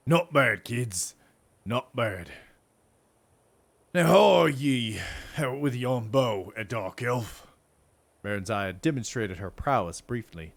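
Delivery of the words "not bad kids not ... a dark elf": lightly voiced gruff old sergeant, amused; a hint of a chuckling veteran soldier. Sergeant sizing up the young recruits, lightly gruff.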